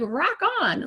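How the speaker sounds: high cheer with the high five. Retold or imitated. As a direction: celebrate with him. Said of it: "huge high five".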